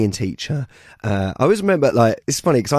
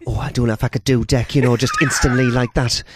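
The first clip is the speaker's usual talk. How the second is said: a light Geordie like Dec; lightly voiced Geordie presenter; Geordie accent. Geordie accent as Dec, caught off guard. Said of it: "my Geordie is always just Deck".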